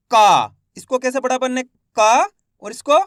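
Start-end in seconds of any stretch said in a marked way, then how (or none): 1.9-3.0 का pushed out slowly with force. Shown as a demonstration. Teacher shows का said with force. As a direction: lean hard on का. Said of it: "जोर लगाएंगे इसके उच्चारण में".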